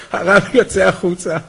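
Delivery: normal talking voice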